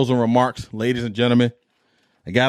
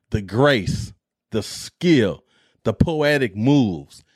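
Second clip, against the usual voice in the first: ordinary talk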